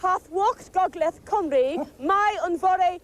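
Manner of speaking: slow, careful Welsh phrase; Welsh attempt. Shown as a demonstration. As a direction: try out the Welsh slowly, showing off. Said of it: "So I'm gonna try again".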